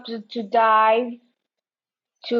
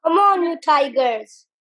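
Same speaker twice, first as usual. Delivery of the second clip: higher, louder rallying yell; yelled cheer; 6 semitones higher, 4 dB louder than usual. Acted. Giles cheering on his team, a rallying yell. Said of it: "Giles yelled".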